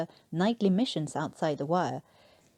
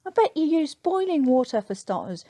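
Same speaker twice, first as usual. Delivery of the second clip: higher and louder, mocking reproach; exasperated scolding; 9 semitones higher, 4 dB louder than usual. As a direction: scold the clueless tea-maker. Newkirk scolding Le Beau.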